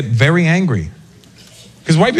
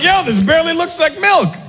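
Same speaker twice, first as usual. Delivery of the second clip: shouted complaint; 10 semitones higher than usual. Acted. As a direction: yell that the milk is watered down.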